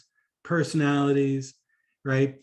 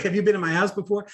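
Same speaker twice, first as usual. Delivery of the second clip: suspicious; 6 semitones higher, faster than usual. Retold or imitated. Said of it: "that same sort of suspicious tone".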